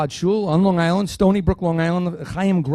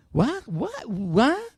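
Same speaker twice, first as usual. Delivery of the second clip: baffled what; 6 semitones higher, 6 dB quieter, much slower than usual. Retold or imitated. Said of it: "will look at you like".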